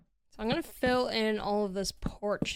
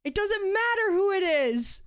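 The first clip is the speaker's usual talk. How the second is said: high, slow, whiny mock wail; exasperated friend; 9 semitones higher, slower than usual. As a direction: drag it out like a fake sob of exasperation. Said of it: "she gets so mad every time".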